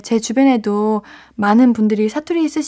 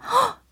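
gasp; 12 semitones higher, 6 dB quieter, slower than usual. Real, not acted. DJ reacts to a listener letter.

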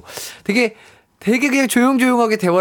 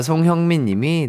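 ordinary delivery

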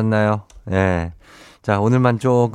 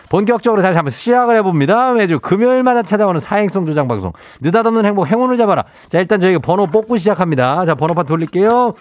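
game show hype; 9 semitones higher, 3 dB louder than usual. Acted. Kicks off lucky draw segment.

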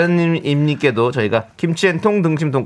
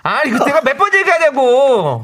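high, exasperated scolding; nagging wife; 11 semitones higher, 3 dB louder than usual. Acted. Wife scolding husband over inside-out socks.

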